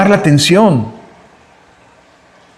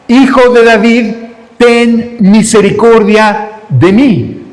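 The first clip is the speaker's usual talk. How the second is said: raised, high plea with drawn-out words; crying out; 4 semitones higher, slower than usual. Acted. Preacher as the Canaanite woman begging Jesus, crying out. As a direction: call out slowly, word by word, for mercy. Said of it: "comienza a clamar".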